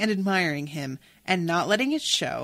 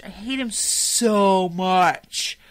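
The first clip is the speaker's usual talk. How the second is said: slow seething hate; 4 dB louder, much slower than usual. Real, not acted. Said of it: "And I hate Cy Tolliver so much".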